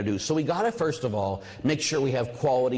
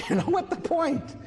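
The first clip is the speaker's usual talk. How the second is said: laughing. Real, not acted. Laughs while asking.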